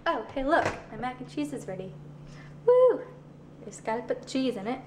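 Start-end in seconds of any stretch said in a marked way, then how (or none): 2.7-3.0 loud high woo; a happy shout of triumph; whoop, much higher and louder; excited cheer. Real, not acted. Cheering mac and cheese ready.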